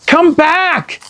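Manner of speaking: put-on high, loud girl's shout. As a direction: yell after him in alarm. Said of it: "Annie shouted".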